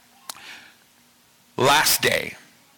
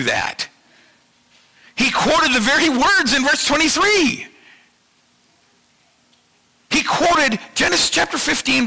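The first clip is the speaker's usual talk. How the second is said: higher and louder, driving preacher's cadence; full-force preaching; 9 semitones higher, 4 dB louder than usual. Acted. Pastor pressing his point on the congregation, full-force preaching.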